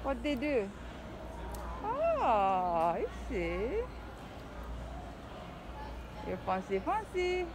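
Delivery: usual speaking voice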